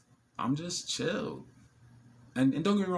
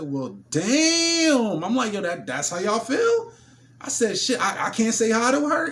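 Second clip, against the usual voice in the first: higher, louder teasing "well, damn"; mock offended; 11 semitones higher, 9 dB louder, slower than usual. Him teasing the men at her table, mock offended. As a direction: play hurt to make them laugh.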